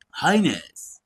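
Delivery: "Highness." said as a respectful bodyguard's greeting with a falling tune; low-voiced greeting. A narrator's reading. Bodyguard Rohit greeting the prince, respectful with a falling tune. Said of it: "Rohit ने धीमी आवाज में कहा".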